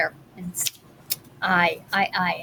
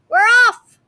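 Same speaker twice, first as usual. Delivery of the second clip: higher, louder crew cry; crew shouting; 11 semitones higher, 8 dB louder than usual. A narrator's reading. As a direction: shout it all together. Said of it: "they all shouted".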